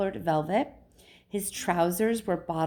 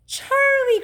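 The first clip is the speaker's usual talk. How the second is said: very high, loud cry of delight; loud, soaring storybook greeting; excited cry; 9 dB louder than usual. A narrator's reading. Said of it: "cried Mister Wonka".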